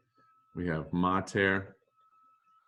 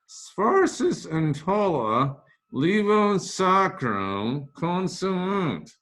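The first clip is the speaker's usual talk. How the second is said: high, slow voice from above; mock divine narrator in Latin; booming narrator; 8 semitones higher, 3 dB louder, much slower than usual. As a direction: announce it like a voice from the heavens. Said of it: "I was reading for the narrator".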